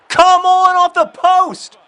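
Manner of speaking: high, loud, drawn-out cry; agonized shout. Real, not acted. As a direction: yell as the ball hits the post. Shot hits the post.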